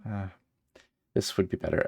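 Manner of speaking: ordinary talk, nothing marked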